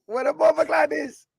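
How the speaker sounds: high squeaky mock Jamaican; shrieked put-on patois; Jamaican accent. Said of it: "that's not a Jamaican accent".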